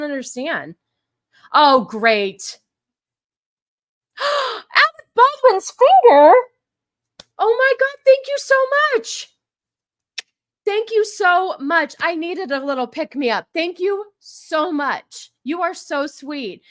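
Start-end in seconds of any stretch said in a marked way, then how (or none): 4.2-9.3 high, loud, delighted gasp; gasping surprise. Real, not acted. Gasping at a big donation. 10.7-16.6 gushing thanks. Real, not acted.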